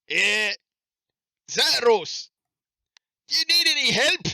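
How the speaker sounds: loud, high-pitched shout; calling out. Acted. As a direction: holler an offer of help across the distance. Player calls out to gnome.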